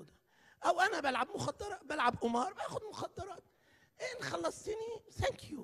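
careless prayer. Pretend. Preacher mocking a careless sinner's prayer, mock offhand. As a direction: pray like you don't care. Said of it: "وبنسمع صلوات كده".

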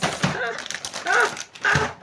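high, gasping bursts of laughter; overjoyed laugh. Real, not acted. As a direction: laugh in separate high whoops. Overjoyed by all the gifts.

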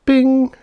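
a high, loud ding like a kitchen timer; timer bing. A narrator's reading. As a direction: ring it out as the timer's bell. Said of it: "said the timer".

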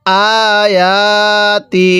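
আয়াতি very slow, both a vowels held; measured tajweed model of আয়াতি; elongated madd. Shown as a demonstration. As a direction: stretch out "aa-yaa" in আয়াতি. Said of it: "এক আলিফ টানতে হবে".

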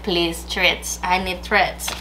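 mock pleading as the dog, understated; voicing the dog. Owner speaking for her dog begging for treats, lightly voiced. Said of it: "He's like".

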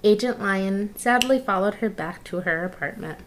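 usual speaking voice

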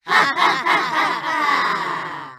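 drawn-out alien "ha ha ha"; mock heroic laugh. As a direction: laugh boldly in the face of danger, in alien voice. Hero laughs at danger, aliens laugh.

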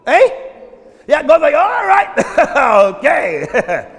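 loud, upbeat wake-up call; cheerful God. Retold or imitated. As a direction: rouse the day with glee. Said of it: "God gets up and like".

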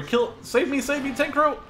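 ordinary delivery

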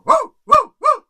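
very high, very loud barking; dog barking. Acted. Dog barking at the snoring rooster, loud and high. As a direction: bark hard to wake someone. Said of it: "le dijo al perro".

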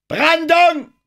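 very high, loud warning shout; young nervous cry. A narrator's reading. Young lookout warns from the crow's nest, high nervous shout. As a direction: shout the alarm at high pitch. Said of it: "nervöse Stimme vom Krähennest".

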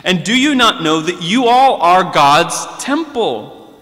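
ordinary talk, nothing marked

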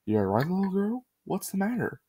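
a hint of a concerned puppet; Snuffy's voice.

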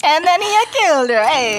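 high, loud mock Italian storyteller accent; Italian accent, playful. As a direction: ham up an Italian narrator. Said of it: "using an Italian accent".

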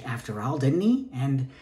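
ordinary talk, nothing marked

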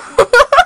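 high loud chuckle; bubbling chuckle. A narrator's reading. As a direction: chuckle growing. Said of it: "The giggle grew into a chuckle".

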